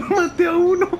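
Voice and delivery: panicking gamer. Real, not acted. Excited kill shout.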